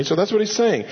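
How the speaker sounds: ordinary talk, nothing marked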